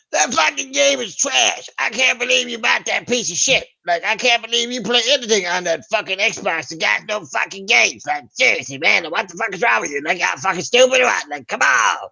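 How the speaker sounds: mock a toxic fanboy trashing your console; angry troll rant. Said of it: "dipshits that go".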